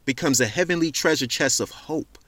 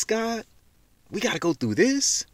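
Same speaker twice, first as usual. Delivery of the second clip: higher, disbelieving plea to God; pleading lament; 10 semitones higher than usual. Retold or imitated. Believer questioning God about suffering, high and pleading. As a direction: ask God if this must be.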